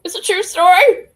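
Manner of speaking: loud, high parroting of the clip; mocking echo. Echoing Jelly's line from clip.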